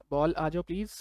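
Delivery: usual speaking voice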